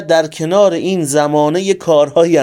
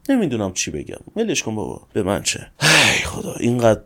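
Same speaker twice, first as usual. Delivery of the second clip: low, subdued, worn-out grumbling; real exasperation, voice sinking low and soft; weary exasperation; 9 semitones lower, 4 dB quieter than usual. Real, not acted. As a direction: give up with a tired, low complaint. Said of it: "اینقدر حرص اینا رو خوردیم".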